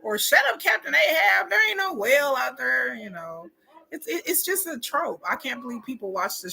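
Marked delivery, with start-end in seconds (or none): 0.2-2.9 acted dismissive shout. Crew dismissing the captain's warning, mock angry shout. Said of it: "there's always one person".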